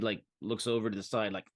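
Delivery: ordinary talk, nothing marked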